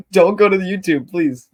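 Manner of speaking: high, loud plea through laughter; laughing plea. Real, not acted. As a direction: beg them off, laughing and embarrassed. Laughing plea to avoid YouTube.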